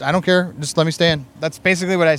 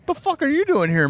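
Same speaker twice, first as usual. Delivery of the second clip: retold surprised greeting; 11 semitones higher than usual. Acts out surprised greeting in Kuwait.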